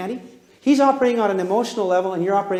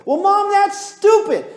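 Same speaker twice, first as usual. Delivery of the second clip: put-on high, loud scoffing child; sulky kid; 12 semitones higher, 5 dB louder, slower than usual. Kid scoffs at Mom's answer, high and loud. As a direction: sneer at Mom like a teen.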